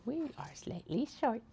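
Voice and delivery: high little voice for too-short bag pieces; bag bottoms talking. Acted. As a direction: pipe up as a cheeky talking object, high-pitched. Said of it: "the bottoms are all like".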